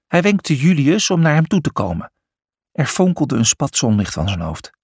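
plain reading voice

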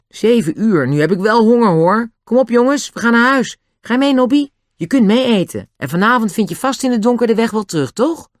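high, lightly excited chatter; lightly acted eager child, pitched well up; eager rallying. A narrator's reading. Hungry boy calls friends home to eat, mildly excited.

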